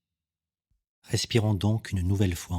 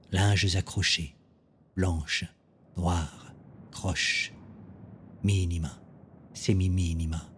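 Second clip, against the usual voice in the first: hushed, slow bilingual poetry reading; poem recitation. Acted. Poet evoking the wind in exile, slow sparse recitation. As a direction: space out each short phrase like a breath.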